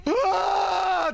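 sudden high scream of fright; startled scream. Real, not acted. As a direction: yelp in real fear. Scared by multiplying monsters.

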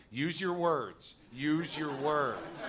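slow, deliberate therapist voice; earnest imitation. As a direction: mimic her patient, earnest coaching.

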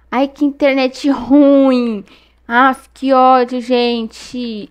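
raging at the lag; indignant. Real, not acted. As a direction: blast the bad internet. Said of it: "tô muito indignada".

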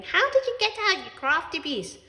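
high, loud furious yell at the cat; angry yell. A narrator's reading. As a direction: bellow at the escaped cat. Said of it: "Ellie's father yelling".

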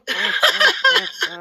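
squealing high laugh. Real, not acted. Laughs at confusion.

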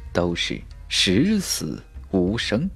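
ordinary delivery

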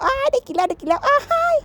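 high, gleeful repeated cries; delighted squeal. Real, not acted. Celebrating getting to eat the fruit, delighted squeal. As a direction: cheer in a high voice, overjoyed. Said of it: "ดีใจหันแล้ว".

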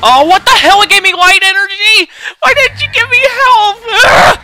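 high, loud outraged yelling, near crying; furious outburst. Real, not acted. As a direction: rage at the game, voice cracking. Angry at game giving wrong item.

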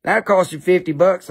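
usual speaking voice

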